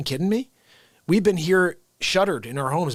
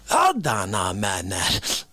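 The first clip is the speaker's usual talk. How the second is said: much lower, slow deadpan pause; low slow hold-on; 10 semitones lower, slower than usual. Acted. Pausing the rant to dunk biscotti, low and slow. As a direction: drop the voice for a comic beat.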